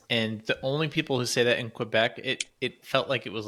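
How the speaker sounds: usual speaking voice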